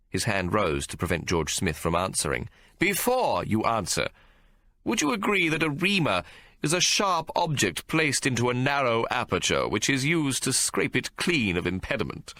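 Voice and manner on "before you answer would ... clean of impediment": a lightly voiced barrister, a touch higher and measured; barrister's questioning. Barrister cross-examining the policeman, lightly voiced and measured. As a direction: press the witness with courtroom poise.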